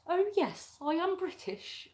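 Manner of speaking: hammed-up English accent impression; mock British accent. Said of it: "like Lara Croft".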